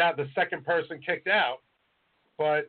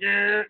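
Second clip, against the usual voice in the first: raised, louder horn blare; imitated truck honk; 4 semitones higher, 5 dB louder than usual. Dump truck behind them honking, imitated with the voice. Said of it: "honking, like".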